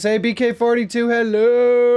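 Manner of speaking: high and loud "hello!" called out; loud happy greeting. Real, not acted. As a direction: call out the greeting to a friend. Greeting a viewer by name.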